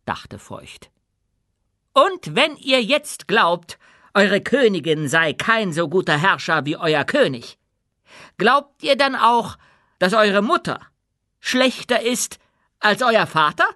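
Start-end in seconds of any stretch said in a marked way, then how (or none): 1.9-13.7 high, raised, deliberate oratory; challenging queen. A narrator's reading. Queen challenges doubting subjects about their mothers, loud and high.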